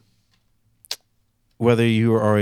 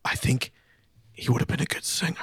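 whispered mocking parent voice; breathy whispered parent impression; imitating parents, whispered; 7 dB quieter than usual. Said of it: "and they're like".

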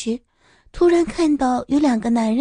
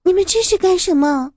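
frightened cry; 7 semitones higher than usual. Acted. Frightened girl shouts at attacker.

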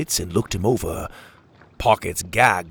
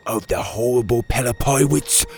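awed fearful telling; 4 semitones higher than usual. A narrator's reading. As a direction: build up the horror of the pirates. Petey describes feared pirates.

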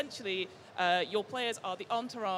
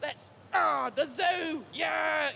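put-on loud rowdy East London accent, higher; 7 semitones higher, 5 dB louder, much slower than usual. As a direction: shout it like an East London geezer. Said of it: "gonna get my East London accent out".

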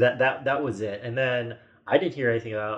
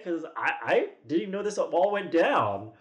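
ordinary talk, nothing marked

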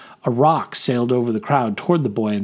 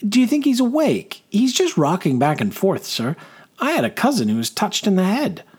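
higher-pitched, lilting voice of a humble soldier; eager young private; 6 semitones higher than usual. Acted. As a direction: play the simple private explaining to his officer, pitched up. Young private Rospin speaks to his superior.

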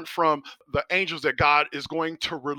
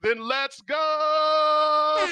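long, stretched-out high call; drawn-out hype call; 13 semitones higher, much slower than usual. Acted. Hyping listeners, drawn-out call.

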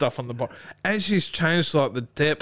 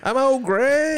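high, louder creepy character voice; Old Greg voice; 10 semitones higher, 4 dB louder, slower than usual. Retold or imitated. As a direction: announce it high and strange. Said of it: "You sound like old Greg".

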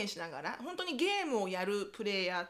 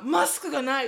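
louder mock panic, laughing; panicked son; 3 semitones higher, 10 dB louder than usual. Son telling his mom he forgot the mask, loud mock panic. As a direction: blurt it out in alarm.

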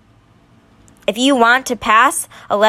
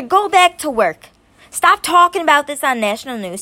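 worked up; 6 semitones higher than usual. Real, not acted. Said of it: "I'm getting a little worked up".

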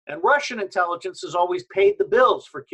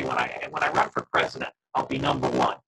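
glitchy robotic call distortion; garbled digital robot voice. Real, not acted. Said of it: "They just turned you into a robot".

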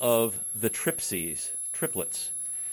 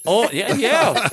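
excited; 10 semitones higher, 9 dB louder, slower than usual. Real, not acted. Host cheering enthusiastically.

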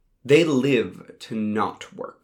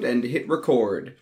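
plain reading voice

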